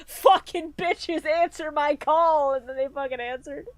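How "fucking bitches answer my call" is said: dragged out, drawn-out dramatic call; hammed-up slow invocation; slow mock summons. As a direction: call the gods out slowly, hamming it up. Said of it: "And he's just like".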